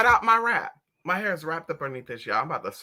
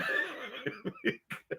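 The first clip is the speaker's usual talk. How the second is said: very high squeaky laugh; 12 dB quieter than usual. Real, not acted. Cracking up at the child, squeaky high laugh.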